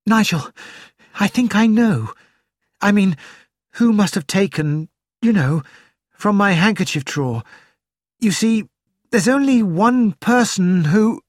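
breathless, high and hurried; frightened and short of breath; breathless fright. A narrator's reading. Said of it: "The girl's voice sounded breathless".

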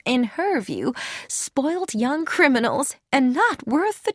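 a hint of a serene old woman shrugging off worries; Carrie Louise. A narrator's reading. Carrie Louise voiced, higher.

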